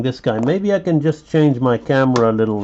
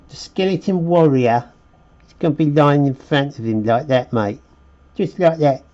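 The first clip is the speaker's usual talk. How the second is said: put-on higher-pitched playful Cockney accent; British Cockney; 4 semitones higher than usual. Artist playing around while placing his skeleton warrior, British Cockney accent. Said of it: "felt compelled to be like a British cockney".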